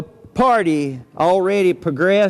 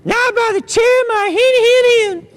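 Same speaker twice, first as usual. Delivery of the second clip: much higher squeaky pig voice; squeaky little pig; 13 semitones higher than usual. Acted. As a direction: pipe it up high like a tiny pig. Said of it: "Pidlig says".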